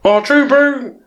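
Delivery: put-on high, hammy New Zealand accent; Kiwi accent. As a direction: drawl it like a Kiwi bloke. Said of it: "a Kiwi accent in it".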